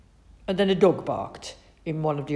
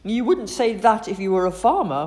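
frowning mum; 5 semitones higher, 7 dB louder than usual. A narrator's reading. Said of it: "frowned Mum".

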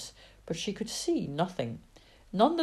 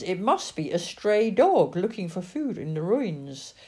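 ordinary delivery

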